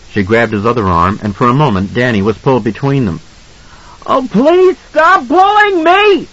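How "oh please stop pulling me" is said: put-on very high, drawn-out child's scream; agonized scream. A narrator's reading. Boy pulled between parents, high agonized scream. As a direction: scream it in agony, stretching the words. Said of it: "he screamed at them".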